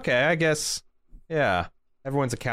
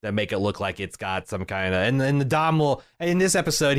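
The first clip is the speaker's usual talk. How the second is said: normal talking voice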